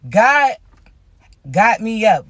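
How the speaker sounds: ordinary talk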